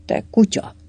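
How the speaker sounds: short, indignant insult; narrated snort of outrage; angry snort. A narrator's reading. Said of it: "horkant fel Kobjenszki".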